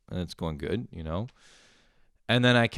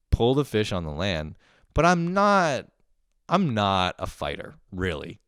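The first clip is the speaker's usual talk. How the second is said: ordinary talk, nothing marked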